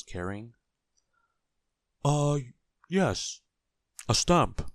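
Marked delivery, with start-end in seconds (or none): 2.0-4.7 high, loud burst of recognition; raised voice as the penny drops; sudden remembering. A narrator's reading. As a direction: seize on the answer with delight. Forgetful man suddenly remembers.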